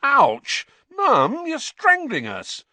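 high, loud yelp of complaint; squeezed boy protesting to his mum; pained protest. A narrator's reading. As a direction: yelp it like a squashed son. Boy protests a crushing hug.

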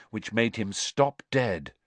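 ordinary delivery

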